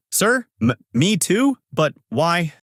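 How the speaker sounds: high, stammering nervous question; trembling. A narrator's reading.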